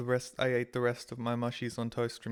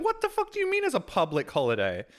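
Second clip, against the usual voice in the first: indignant disbelief; 11 semitones higher than usual. Retold or imitated. Reacting to public holiday notice.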